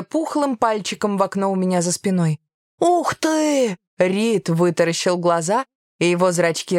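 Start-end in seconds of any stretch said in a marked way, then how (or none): 2.8-3.8 put-on high-pitched childlike wonder; amazed child. A narrator's reading. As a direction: gasp out a child's delighted wow. Amazed pupil sees the cloud.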